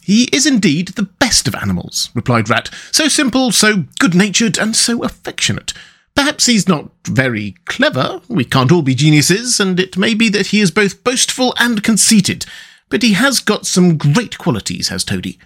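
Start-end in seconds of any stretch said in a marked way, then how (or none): 0.0-2.1 higher, lightly voiced rat; lively storybook animal dialogue; good-natured rat. Rat praises Toad in story. 2.9-15.4 high, fond character voice; lilting storybook rat; good-natured rat. Acted. Rat praises Toad in story.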